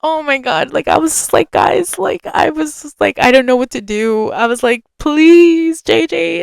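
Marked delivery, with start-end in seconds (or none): 5.0-6.4 high, drawn-out plea; imitated pleading, pitched well up; retold begging. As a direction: plead with a friend, stretching the please. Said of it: "And I kept begging".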